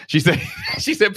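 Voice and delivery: laughing while talking. Real, not acted. Laughing while retelling wife's warning.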